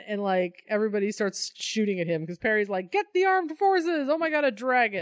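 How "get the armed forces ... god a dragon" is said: frantic editor yelling for troops; panicked shout. Retold or imitated. Perry panicking at a dragon.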